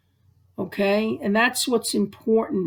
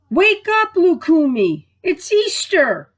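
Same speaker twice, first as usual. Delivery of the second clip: put-on higher, louder mom waking her child; mom calling out; 7 semitones higher, 4 dB louder than usual. Mom calling her daughter awake on Easter morning, high and loud. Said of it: "her mom said".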